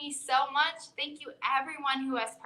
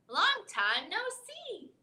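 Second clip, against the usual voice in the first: Hare voice; 8 semitones higher than usual. A narrator's reading. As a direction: keep the high Hare voice. Hare greets Mouse.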